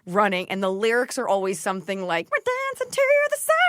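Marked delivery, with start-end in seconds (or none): 2.3-3.5 very high squeaky sped-up singing voice; hamster voice. Shown as a demonstration. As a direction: chirp it like a tiny hamster singer. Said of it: "I imagine a hamster in a tube".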